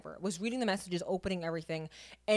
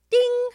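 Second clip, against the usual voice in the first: high, loud imitated phone ding; ping it out like a phone alert; mock message chime; notification ding; 13 semitones higher, 8 dB louder than usual.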